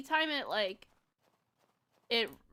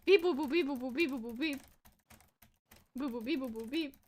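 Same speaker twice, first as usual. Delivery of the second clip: beep boop noises. Pretend. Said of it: "That's me dancing in the game".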